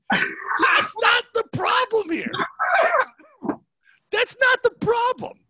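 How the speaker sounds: high, loud, drawn-out mock outrage; exasperated shout. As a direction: blow up at the heckler, hammed-up. Retold outburst at audience member.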